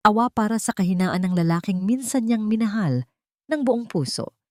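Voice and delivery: usual speaking voice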